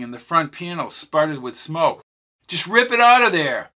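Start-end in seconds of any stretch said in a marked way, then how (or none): 2.5-3.7 urgent shout. Acted. Urgent plea on lurching ship.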